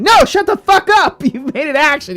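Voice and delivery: loud, high, slowed outburst; hammed-up yell shutting a player down; mock-furious game master shout; exasperated game master. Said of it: "And I'm like".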